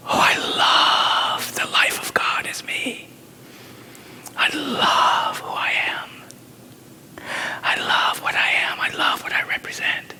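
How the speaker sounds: hushed, breathy prayer whisper; whispered affirmation. Acted. Preacher to congregation, whispering the affirmation. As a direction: draw them in with a soft whisper.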